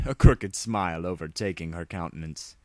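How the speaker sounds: usual speaking voice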